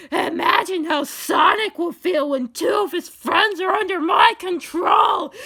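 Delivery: high, loud maniacal villain; gloating villain. A narrator's reading. X boasts of controlling Sonic's friends, shouting gleefully. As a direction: crow with wicked triumph.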